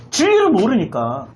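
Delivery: ordinary delivery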